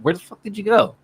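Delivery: mildly annoyed retold shout; exasperated call-out. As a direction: call out to a vanished teammate. Searching for vanished teammate.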